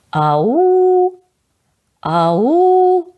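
громкий зов «Ау!», высоко и протяжно; кричите «Ау!» изо всех сил в тёмный лес; крик в лесу. A narrator's reading. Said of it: "Тут мы как закричим вместе". (громко зовём «Ау!» в лесу)

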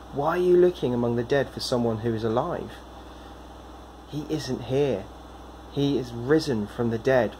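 ordinary delivery

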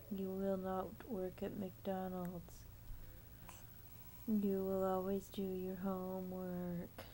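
deep mock-hypnotic trance voice; hypnotist voice. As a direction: lull the baby like a stage hypnotist. Parent plays hypnotist to baby.

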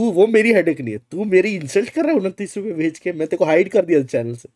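ordinary delivery

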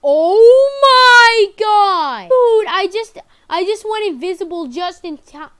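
high, very loud panicked cry; panicked scream. Real, not acted. Narrow escape from the neighbor.

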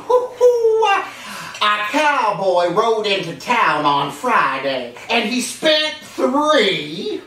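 high, folksy Texas cowboy, chuckling; hammed-up cheerful cowboy telling jokes; Cowboy Bob. Puppet cowboy character throughout.

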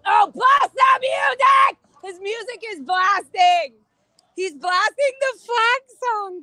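loud, high, slow protest shout; shouting over music. Real, not acted. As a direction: yell it over the blaring music.

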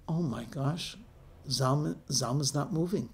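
soft, stunned realisation; hushed alarm. Acted. Mother finding her son motionless, hushed alarm. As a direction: let it out quietly in growing disbelief.

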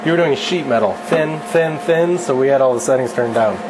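ordinary delivery

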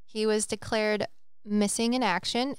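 ordinary talk

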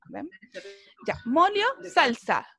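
ordinary delivery

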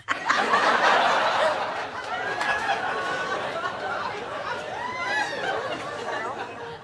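long, high-pitched giggling; can't stop giggling at her own prank; high giggle fit. Real, not acted. Vlogger waits for a friend to answer, giggling high. Giggling before next call.

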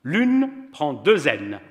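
slow, loud and high, each word laid down; slow insistence. A narrator's reading. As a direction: spell it out pedantically. Pierrot insists on spelling.

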